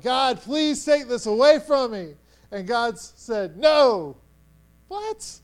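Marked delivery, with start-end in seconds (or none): 0.0-2.1 higher, louder acted begging; pleading. Paul begging God to remove the thorn, urgently pleading. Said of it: "he's pleaded with God three times". 3.6-4.2 loud, emphatic single word; firm refusal. Acted. God refusing Paul's plea, loudly and firmly. 4.9-5.4 very high, small squeak of disbelief; incredulous shock. Acted. Believer hearing God say no, squeaky and incredulous. Acts shocked reply to God's no.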